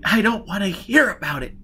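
high, loud, cutting interruption; snapped. Acted. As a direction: cut him off angrily. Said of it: "Giz snapped".

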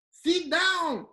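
"Sit down" shouted high and loud; exasperated shout to a confused listener; mock shouting. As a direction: yell it like an impatient parent. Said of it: "o que você tá falando?".

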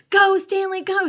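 high, loud urging shout; excited classmate's yell; cheering yell. A narrator's reading. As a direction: cheer him on from below. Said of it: "yelled Manny".

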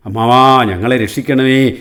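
storyteller's acted wail of alarm; pleading wail. A narrator's reading. Frightened fish beg the old crane to save them, wailing. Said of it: "എന്നവ കൂട്ടത്തോടെ നിലവിളിച്ചു".

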